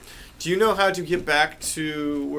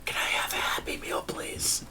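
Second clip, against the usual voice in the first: whisper; slower than usual. Acted. Whispered joke order in library.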